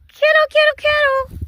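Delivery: high repeated coaxing call; calling animals. Real, not acted. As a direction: call them over in a high little voice.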